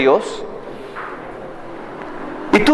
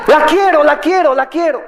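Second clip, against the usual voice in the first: higher, lightly voiced whiny child; whining child; 8 semitones higher than usual. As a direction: beg like a kid wanting candy. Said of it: "Como un niñito que llora por un caramelo".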